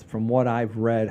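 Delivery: ordinary talk, nothing marked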